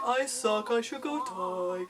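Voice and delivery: slowing, self-pitying mock moan; mock victim-y. Inner voice getting victim-y, mock self-pitying moan. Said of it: "victim-y, saying things like".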